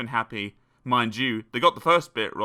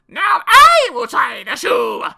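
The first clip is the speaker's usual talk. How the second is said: slow, high, very loud screech; drawn-out high-pitched shout; yelled; 14 dB louder than usual. A narrator's reading. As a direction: yell it as the pushy ugly sister. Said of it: "who yelled".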